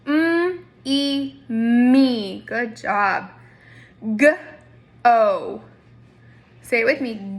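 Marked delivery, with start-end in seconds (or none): 0.0-2.4 "Mm", "e" split, then "me" said loud; teacher blends sounds into a word; blending sounds. Shown as a demonstration. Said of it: "If I push those together".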